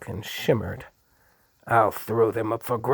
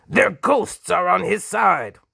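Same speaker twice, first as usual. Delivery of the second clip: much higher and louder furious cry; apoplectic rage; 10 semitones higher, 8 dB louder than usual. Acted. The apoplectic Duke rages at Hark, high and loud. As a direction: explode with rage.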